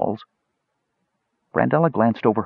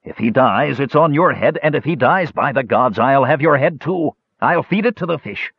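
higher-pitched, heated threat; angry bellow; 7 semitones higher than usual. A narrator's reading. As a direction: threaten them as a furious old fisherman. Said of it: "bellowed the old fisherman".

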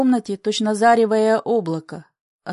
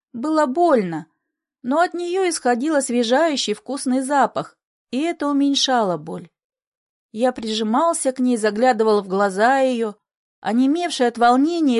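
usual speaking voice

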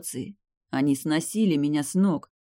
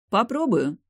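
higher-pitched, provoking retort; defiant interruption; 7 semitones higher than usual. A narrator's reading. Bride cuts off the groom's warning, defiant. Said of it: "перебила меня Высшая".

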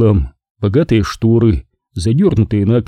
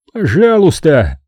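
harsh cry; 7 semitones higher, slower than usual. A narrator's reading. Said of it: "вскричал Куртни жёстким голосом".

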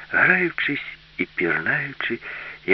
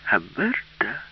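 ordinary delivery, nothing marked

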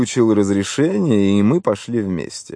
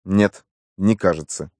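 curt refusal. A narrator's reading. Slack rebuffing the nephew's theory, curt. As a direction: cut the questioner off without raising the voice. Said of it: "отрезал Слак".

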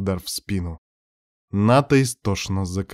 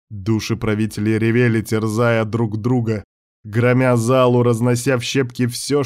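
ordinary delivery

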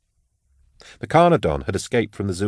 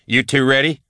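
calling over shoulder; 5 semitones higher, 3 dB louder than usual. A narrator's reading. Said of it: "she called over her shoulder".

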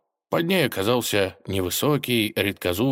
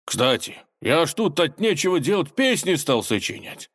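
higher, eager interruption; hurried eagerness; 9 semitones higher than usual. A narrator's reading. Robber cutting in with his new hobby, eager. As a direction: jump in keen to share. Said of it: "заторопился он".

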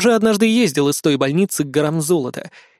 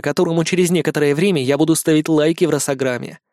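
ordinary delivery

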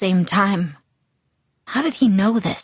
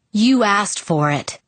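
growled; 2 semitones higher, slower than usual. A narrator's reading. Jacob to Bella, warning before he tells her, slow menacing growl. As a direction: warn her slowly, menacing. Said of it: "he growled at me".

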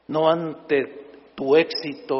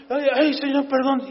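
sheepish John; 8 semitones higher than usual. Pretend. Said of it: "Yo imagino a Juan levantando y dice".

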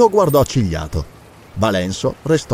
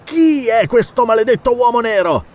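impatient, raised-pitch challenge; angry demand; 9 semitones higher than usual. Acted. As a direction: demand a straight answer, voice pitched up. Character angrily demands an answer.

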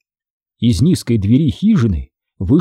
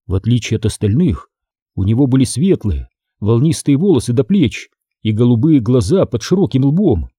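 ordinary delivery

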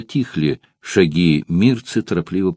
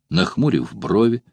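plain reading voice

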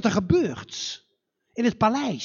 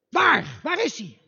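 eager king; 5 semitones higher than usual. Acted. King hears son returned.

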